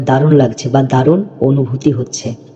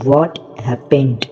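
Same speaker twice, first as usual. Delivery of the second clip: very slow model reading; much slower than usual. Shown as a demonstration. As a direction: try it out, each word drawn out very slowly. Teacher models English phrase slowly. (খুব ধীরে ধীরে স্পষ্ট উচ্চারণ)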